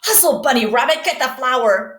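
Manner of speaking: loud, bossy commands; barking orders. Acted. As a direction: bark it like a gruff boss. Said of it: "barking orders".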